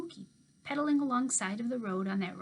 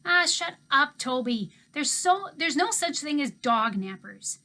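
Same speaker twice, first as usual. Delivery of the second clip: louder and higher, brushing off her brother; angry snap; 6 semitones higher, 8 dB louder, slower than usual. Acted. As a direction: tell Toby to shut up, fed up. Georgina snaps at Toby.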